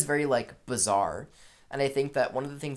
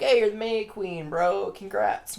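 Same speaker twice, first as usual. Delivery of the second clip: higher-pitched, playful cheer; mock congratulations; 7 semitones higher, 3 dB louder than usual. Villagers hail Danny as May Queen, mock congratulations. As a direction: hail the winner in a mock-excited voice.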